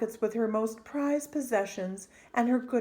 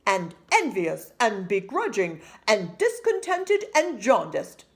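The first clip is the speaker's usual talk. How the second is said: louder father listing it off; indignant father; 3 semitones higher, 8 dB louder than usual. A narrator's reading. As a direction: pile the words on like a proud dad. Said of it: "said her father".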